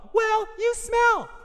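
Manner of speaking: slow, loud falsetto comeback; hammed-up falsetto quarreler; bickering partner. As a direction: drag it out in a squeaky put-on voice. Acting both sides of a quarrel.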